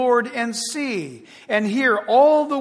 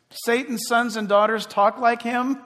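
usual speaking voice

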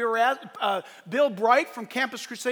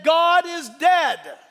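slow, loud stern pronouncement; slow loud cold decree; communist decree; 3 semitones higher, 7 dB louder, much slower than usual. Retold or imitated. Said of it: "took out the Bibles and said".

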